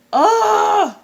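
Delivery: loud, high, drawn-out "oh"; overcome exclamation. Real, not acted. Fan reliving the Poison routine, overcome with delight. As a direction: let out a big high cry of delight.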